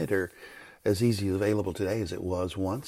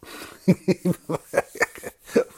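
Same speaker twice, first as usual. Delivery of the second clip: a short, airy laugh at himself; a real laugh at himself; 9 semitones higher, 7 dB louder than usual. Real, not acted. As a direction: laugh off the missed goal. Laughs at never meeting goal.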